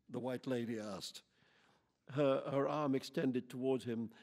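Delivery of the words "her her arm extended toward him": ordinary delivery, nothing marked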